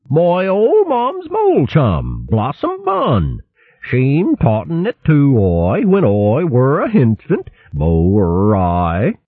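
high, slow hare in mole dialect; broad west country burr, up high; west country accent. A narrator's reading. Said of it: "Dotty answered in the quaint mole dialect".

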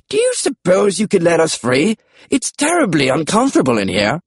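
British pterodactyl, pitched high; high British character voice; British accent. A narrator's reading. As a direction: ask as a very British dinosaur. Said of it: "British accents were unexpected".

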